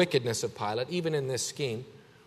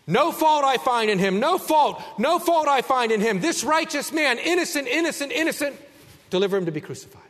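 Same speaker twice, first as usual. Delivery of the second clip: louder, much higher, hammed-up innocence; mock Pilate; 9 semitones higher, 4 dB louder than usual. Preacher mocking Pilate's claim of innocence, loud and high. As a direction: throw up the hands as Pilate, overplaying.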